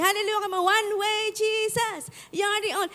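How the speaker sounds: high, hyped-up song leader call. Retold or imitated. Church song leader hyping the crusade crowd.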